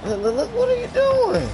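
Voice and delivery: high, incredulous what-are-you-doing; shrill shout at a careless teammate; exasperated outburst. Real, not acted. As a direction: voice jumps way up in disbelief. Yelling at teammate mid-game.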